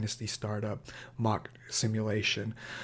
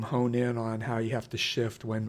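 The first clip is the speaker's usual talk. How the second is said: normal talking voice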